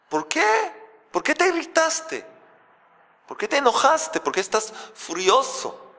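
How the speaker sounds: high, pleading voice of the Creator; the Creator asking. Acted. The Creator asking Cain why he is angry, high and pleading. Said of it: "Le dice lo siguiente".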